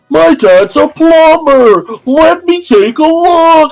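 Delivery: high, slow hammed-up kid offering help; Bobby offering to fix it, high and slow; eager kid. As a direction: pitch it up and drag it like a proud kid. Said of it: "Bobby's like".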